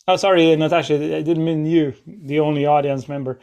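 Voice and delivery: ordinary delivery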